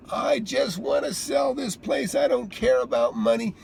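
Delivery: tearful plea. Retold or imitated. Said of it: "she bursts into tears".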